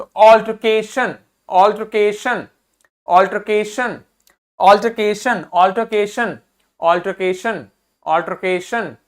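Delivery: Altercation with के stressed and drawn out; repeated teaching drill of altercation, stressing के. Shown as a demonstration. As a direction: lean on the के in altercation each time. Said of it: "इस पे जोर देना है".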